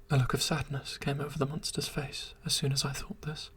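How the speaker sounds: ordinary delivery, nothing marked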